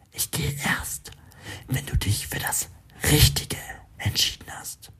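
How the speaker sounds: whispered voice inside his head; whispery telepathic voice. A narrator's reading. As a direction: breathe it like a voice in his mind. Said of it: "Erklingt es erneut in seinem Kopf".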